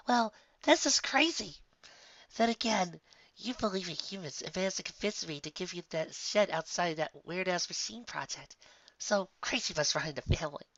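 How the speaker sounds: high, teasing mare voice; Bon Bon's voice. Acted. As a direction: rib your friend in a lifted pitch. Bon Bon teasing Lyra back.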